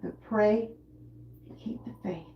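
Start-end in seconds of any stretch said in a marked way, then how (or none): 1.5-2.3 whispered faith. Real, not acted. Prayerful aside after pray.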